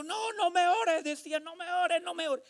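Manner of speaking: high, slow pleading no me ores; a man panicking at being prayed over, pleading high and slow; frightened begging. Retold or imitated. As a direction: beg him to stop praying. Man begs him not to pray.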